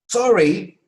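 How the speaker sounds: loud, high-pitched cry; exclaimed apology. Shown as a demonstration. Teacher models apologising when upset, exclaimed. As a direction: cry out your regret. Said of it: "haykırarak söylüyorsunuz".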